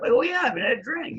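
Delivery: cheerful reply. Retold or imitated. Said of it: "He's going".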